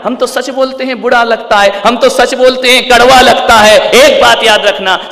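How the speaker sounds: high, mounting voice of protest; loud indignant preaching. Acted. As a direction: build the people's complaint into a loud declaration. Quotes people, then exhorts, raised voice.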